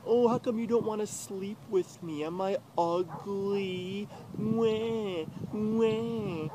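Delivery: high, slow whiny mock cry; mock her fake crying with a high wah; mocking whiny girlfriend. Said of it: "she's like".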